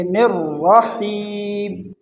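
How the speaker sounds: slow drawn-out رحیم with a heavy ر; heavy R, fine H. Shown as a demonstration. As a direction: recite رحیم, full ر and light ح, long final vowel. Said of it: "را کو موٹا پڑھیں گے". (موٹی را کے ساتھ کھینچ کر)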